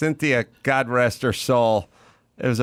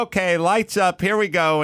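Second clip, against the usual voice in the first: big, blustery teacher calling the start, higher pitched; imitated yelling; 8 semitones higher than usual. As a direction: imitate a teacher's high, bustling stage call. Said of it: "was yelling".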